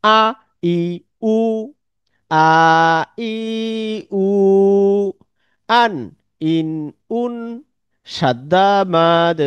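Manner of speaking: each sign said on its own with a pause after it; vowel signs repeated for the class. Shown as a demonstration. Teacher repeats the vowel signs clearly to make them easy for the students. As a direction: say them again slowly so students can follow. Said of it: "আমি একটু আবার বলে দিচ্ছি".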